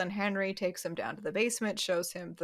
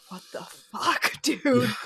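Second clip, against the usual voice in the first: drawn-out mock outrage, rising louder; retold seething; 5 semitones higher, 5 dB louder, slower than usual. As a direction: act out seething disbelief, stretching the words. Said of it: "just sitting there seething like".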